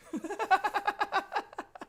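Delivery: a short burst of high laughter. Real, not acted. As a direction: giggle high at your own joke. Laughs at Hot Mike joke.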